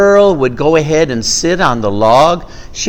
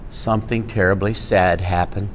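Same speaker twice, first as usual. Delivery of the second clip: hushed sad turn; 9 semitones lower, 9 dB quieter than usual. A narrator's reading. Story turns sad.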